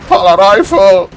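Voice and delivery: loud, high mock-crying wail. Happy crying in the corner over the loss, mock sobbing cry. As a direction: wail it like a sore loser. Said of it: "Happy is crying".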